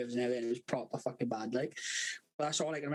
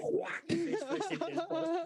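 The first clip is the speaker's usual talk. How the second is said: said through laughter, pitched up; giggling at the punchline; laughing; 14 semitones higher, slower than usual. Real, not acted. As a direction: laugh the words out high. Laughing while telling it.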